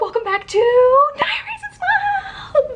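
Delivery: high, bright-eyed channel greeting sung out; high-pitched sing-song vlog intro. Acted.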